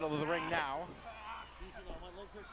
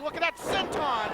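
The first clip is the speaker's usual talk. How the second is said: excited; 9 semitones higher, 4 dB louder than usual. Acted. Excited wrestling play-by-play.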